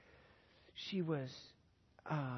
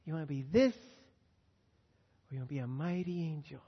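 gentle question; 2 semitones lower than usual. Acted. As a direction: ask her tenderly, drawing it out. Asking his granddaughter, gently.

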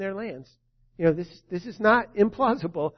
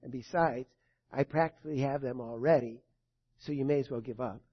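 lightly voiced evil one, lower and softer; 5 semitones lower, 5 dB quieter than usual. Preacher voicing the evil one threatening the listener's kids, low menace. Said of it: "says the evil one".

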